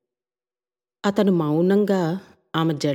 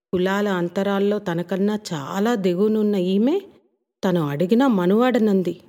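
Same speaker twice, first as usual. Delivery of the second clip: ordinary delivery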